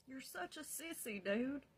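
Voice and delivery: high-pitched teasing jeer; mock shit-talking. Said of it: "just shit talking me".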